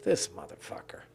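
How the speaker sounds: whispered, voiceless contempt; muttered under breath. Retold or imitated. Sitting and watching the rude producer, whispered contempt. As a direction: hiss it under your breath. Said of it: "I'm just sitting there like".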